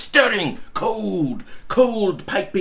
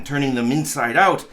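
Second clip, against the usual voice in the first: ordinary delivery, nothing marked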